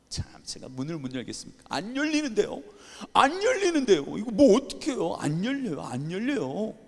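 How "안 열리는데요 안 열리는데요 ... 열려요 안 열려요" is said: high, loud exasperated cry; can't believe the door won't open; frustrated pleading. Retold or imitated. Pastor at the stuck church door, high exasperated cry.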